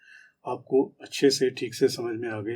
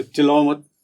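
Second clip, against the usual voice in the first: louder, firm command; firm order; 6 dB louder than usual. Shown as a demonstration. Scolding someone to stop shouting, firmly loud. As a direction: order it sharply. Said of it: "एक से".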